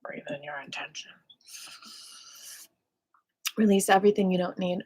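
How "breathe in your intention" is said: soft whispered breathing cue; whispering. Acted.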